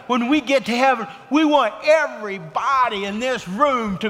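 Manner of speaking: pushing the congregation toward heaven, at full preaching force; fervent preaching. Acted. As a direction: preach it out to the whole room. Preaching climax about heaven.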